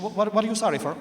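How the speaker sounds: mock wife's sharp question; imitating wife. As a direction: play the baffled wife snapping back. Wife demands explanation.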